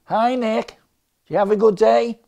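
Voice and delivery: high-pitched chirpy greeting; Californian sister-in-law. Retold or imitated. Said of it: "Holly, my sister-in-law, is born and bred in California".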